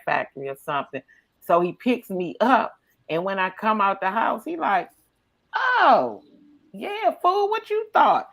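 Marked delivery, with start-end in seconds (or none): 5.5-8.3 high-pitched put-on exclamation; mock amazement. Acting out the driver seeing her dressed up, mock amazement. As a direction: push the voice way up, playfully.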